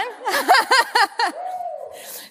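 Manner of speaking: a burst of high laughter; bright laugh. Real, not acted. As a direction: laugh out loud, freely. Teacher laughs at student's question.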